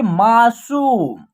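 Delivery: मासूम with the सू stretched out; long vowel. Shown as a demonstration. Teacher giving an example word, मासूम with long ऊ. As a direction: hold the ऊ in मासूम. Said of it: "long तरीके से होगा".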